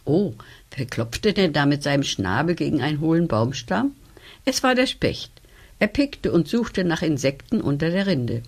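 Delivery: ordinary delivery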